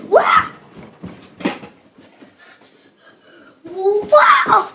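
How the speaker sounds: high, loud amazed shout with a laugh; high loud laughing wow. Real, not acted. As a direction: gasp it out in delight. Amazed at the hit.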